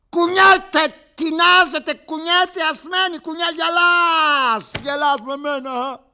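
very high, loud, wild; hysterical shout. Acted. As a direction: scream it at the one laughing. Shouting at laughing listener. (υστερική κραυγή)

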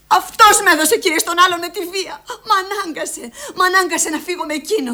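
high, forceful outburst; anguished accusation. Acted. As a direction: accuse him in desperate self-defence. Mother accuses the father. (κατηγορεί με απόγνωση, ψηλά)

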